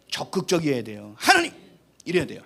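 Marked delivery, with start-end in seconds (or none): 1.2-1.5 high eager cry to God; a loud, high cry of Hanunim; calling out. Shown as a demonstration.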